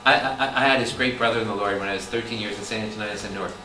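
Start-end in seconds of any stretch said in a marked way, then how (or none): none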